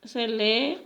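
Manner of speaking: ordinary delivery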